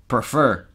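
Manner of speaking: Prefer with the first vowel almost gone, pfer; reduced vowel. Shown as a demonstration. Teacher blending prefer with no vowel. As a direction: swallow the e of Prefer. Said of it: "Imagínate que no existen".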